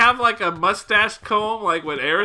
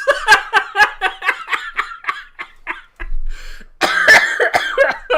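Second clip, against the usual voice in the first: long, high, loud, breathless laugh; real uncontrollable laughing fit; hysterical laughter; 12 semitones higher, 7 dB louder than usual. Real, not acted. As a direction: laugh until no air is left.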